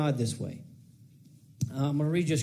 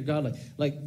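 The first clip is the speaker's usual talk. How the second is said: ordinary talk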